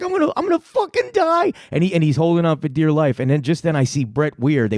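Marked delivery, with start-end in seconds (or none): 0.0-1.5 very high squealing mock terror; retold panicked wail. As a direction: wail it like a man about to die. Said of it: "He's like".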